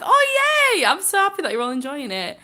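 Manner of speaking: high, loud happy cheer; real delight. Real, not acted. Streamer glad viewers enjoy the live, excited cheer. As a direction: burst out in a delighted squeal, then settle.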